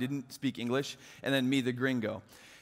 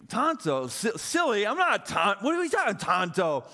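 retold, mock-offended louder protest; indignant disbelief; 5 semitones higher, 5 dB louder than usual. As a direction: act out being offended, half-joking.

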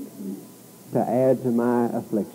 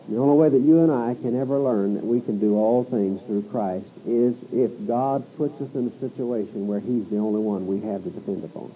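ordinary talk, nothing marked

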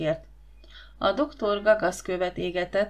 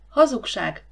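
sharp, louder and higher cry of denial; snapping outburst; 4 semitones higher, 6 dB louder than usual. A narrator's reading. Slave girl flares up at the lie, sharp angry cry. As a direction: flare up at the slander. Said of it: "csattant fel Víbia".

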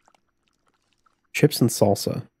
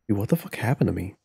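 usual speaking voice

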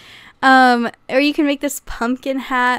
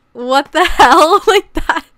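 louder, laughing disbelief; incredulous laugh; 4 semitones higher, 8 dB louder, slower than usual. Real, not acted. To viewers at an axolotl snake costume, laughing disbelief. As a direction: blurt it out, laughing in shock.

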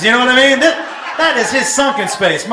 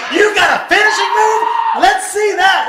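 high, loud excited demand; eager hyped-up shout; 8 semitones higher, 5 dB louder than usual. Acted. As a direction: shout it like a thrilled spectator. Acting his eager reaction to offer.

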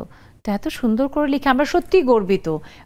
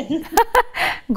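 real laugh; 6 semitones higher than usual. Real, not acted. Host laughs at dialect joke.

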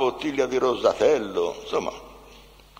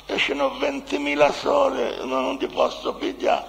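an imitated woman stuck without enough money, pitched up; woman pleading, no money; 11 semitones higher than usual. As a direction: play a flustered woman short of money, stammering high. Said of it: "Apre un borsellino".

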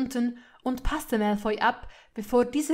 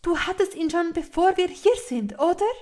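higher, loud, pressing for an admission; annoyed accusation; 8 semitones higher, 8 dB louder than usual. A narrator's reading. Hermine to Malfoy about the hidden letter, annoyed. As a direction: accuse him, pushing up into the question. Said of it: "sagte sie ärgerlich".

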